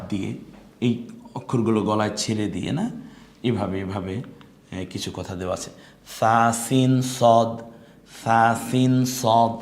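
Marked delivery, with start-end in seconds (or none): 6.2-9.5 সা সিন সদ, drawn out very slowly; slow drill. Shown as a demonstration. Teacher slowly modelling letters for students. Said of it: "পড়তে থাকুন".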